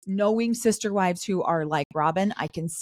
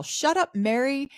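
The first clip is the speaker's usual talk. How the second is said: quick, higher-pitched playful comeback; bantering retort; 7 semitones higher than usual. Retold or imitated. Christine bantering back at Mary, playful retort. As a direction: toss back a mock telling-off. Acted banter between two wives.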